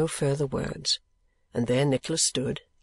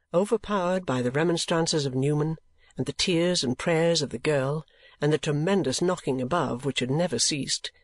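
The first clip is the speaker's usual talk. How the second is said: usual speaking voice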